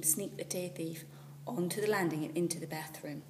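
plain reading voice

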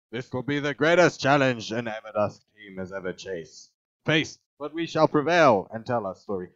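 loud, rousing proclamation; grand speech. Pretend. Player addresses the team on its challenge, grand speech. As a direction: declaim it like a heroic address. Reads game text grandly.

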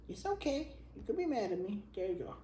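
high soft coo; high cooing comfort; soothing pet voice. Real, not acted. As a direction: reassure the cat gently.

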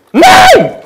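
high furious shriek; rage scream. Real, not acted. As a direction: scream your frustration. Gamer raging after dying.

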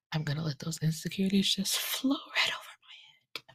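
"I'm gonna let those insecurities" in a soft unvoiced whisper. Real, not acted. Telling viewers about her insecurities, soft whisper. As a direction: breathe it out as a self-soothing aside.

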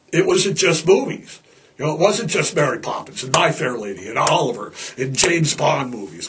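ordinary talk

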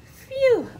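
high, loud worn-out phew; hammed-up tired sigh; exhausted phew. Pooped panda.

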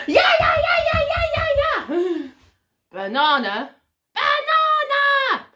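high, loud shrieking fit; hammed-up screaming tantrum; angry monkey tantrum. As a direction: screech like a small monkey demanding fruit. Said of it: "so angry".

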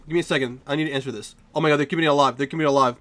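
ordinary delivery

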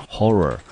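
horror said slowly, HOR-ror; model pronunciation. Shown as a demonstration. Teacher models horror for students, slow and clear. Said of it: "念成horror".

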